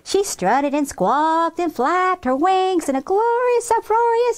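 high, building narration; rousing finale. A narrator's reading. Narrator celebrating the chicken dance, rousing. Triumphant finish of the story.

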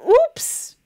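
high squeaky mock oops; a silly little oops as if caught out; playful cartoon oops. Mock mistake on test.

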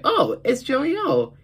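high bright announcing voice; high announcement. Retold or imitated. Kid voicing the show introducing Joey, high. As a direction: pitch it way up like a cartoon. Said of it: "And it's like".

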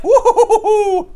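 very high, loud, laughing jig whoop; hammed-up giddy leprechaun cackle. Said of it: "That's my impression of a leprechaun".

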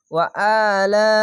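ওয়া আলা chanted with a long "aa"; short ওয়া, then draw out the vowel of আলা; slow recitation of ওয়া আলা; one-alif stretch. Shown as a demonstration. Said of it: "এক আলিফ টেনে পড়বেন".

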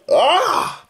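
sudden high yelp of despair; despairing cry. Acted. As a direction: let out a short anguished yelp. Despairing over low views.

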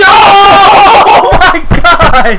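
drawn-out shout through laughter; laughing shout. Real, not acted. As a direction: stretch it out, laughing in disbelief. Laughing shout at a brutal hit.

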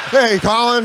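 high, bright hail across a room; mock call out. Mimicking a call to Colin.

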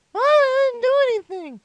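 put-on loud, high kid whine at mom; protesting child. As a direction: protest loudly like a caught five-year-old. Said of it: "my mom heard again".

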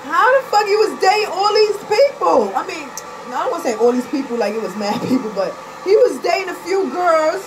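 high, loud cry of disbelief; loud hurt venting; upset outburst. Real, not acted. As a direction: wail it out, hurt and confused. Upset at his dating others.

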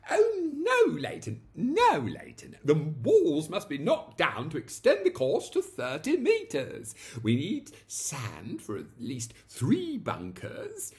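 high, loud, excited insistence; eager correction. A narrator's reading. Young lord correcting his butler, high and loud. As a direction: insist with boyish zeal.

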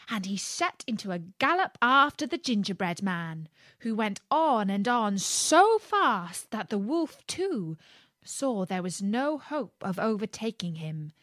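usual speaking voice

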